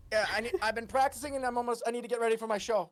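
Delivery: fast, tumbling chatter; hurried. Acted. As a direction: rattle it off in a rush. Halfling hurriedly asks for the bath.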